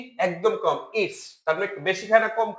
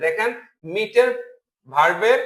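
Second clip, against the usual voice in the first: ordinary talk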